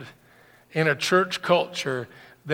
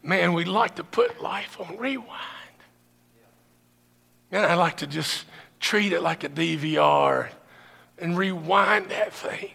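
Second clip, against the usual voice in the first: grieving, wistful; 3 semitones higher than usual. Retold or imitated. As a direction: let the grief pull the words down. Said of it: "Julie and I have often said".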